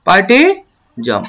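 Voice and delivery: পার্টিজম stretched slowly, each syllable drawn out; slow model pronunciation. Shown as a demonstration. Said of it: "আমি যদি এখন পুরোটা উচ্চারণ করি". (ধীরে টেনে উচ্চারণ)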